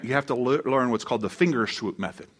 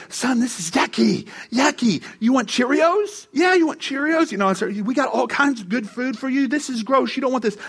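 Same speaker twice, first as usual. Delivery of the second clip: put-on baby talk; 9 semitones higher than usual. Father distracting his angry baby son from a cockroach, sing-song baby talk. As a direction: coax a fussing baby toward a snack.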